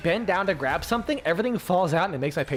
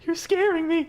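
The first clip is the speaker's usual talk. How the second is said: high, small mock-frightened voice; put-on nervous customer; scared customer; 10 semitones higher than usual. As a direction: squeak it meekly as a spooked customer. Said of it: "And they're like".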